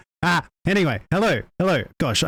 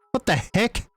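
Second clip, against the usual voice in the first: ordinary talk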